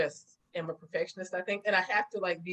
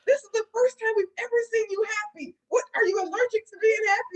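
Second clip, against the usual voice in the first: high, fast mock scolding; 14 semitones higher, 6 dB louder, faster than usual. Friends on the phone, fast, high teasing disbelief.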